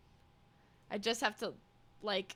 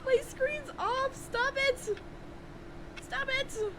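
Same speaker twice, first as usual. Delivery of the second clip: squeaky high voice; 14 semitones higher, slower than usual. Acted.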